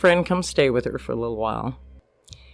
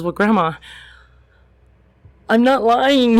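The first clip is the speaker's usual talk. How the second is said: upset plea; 8 semitones higher, 5 dB louder than usual. Retold or imitated. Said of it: "she's really upset".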